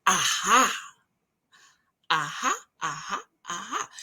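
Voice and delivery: knowing aha. Retold or imitated. Vlogger re-enacting a dawning realization to viewers, knowing. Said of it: "I said, "Aha".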